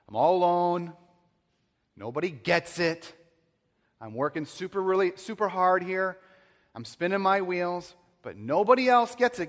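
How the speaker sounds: loud, high, exasperated venting; a believer complaining to God, loud and worn out; frustrated complaint. Acted. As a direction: push it out in a rush of frustration. Our own complaint voiced to God.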